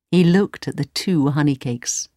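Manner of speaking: usual speaking voice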